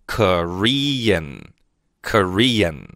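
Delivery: Korean said very slowly twice, final N sound clear; teacher's model word. Shown as a demonstration. Teacher to students, very slow careful model. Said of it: "我们叫Korean".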